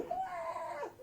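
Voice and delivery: high-pitched imitated scream; mock scream. Campers charged by a moose, high mock scream. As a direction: squeal it like fleeing campers.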